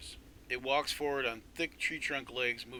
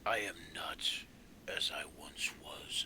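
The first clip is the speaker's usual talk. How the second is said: whispered lament; 7 semitones lower than usual. Acted. Blighted tree laments itself.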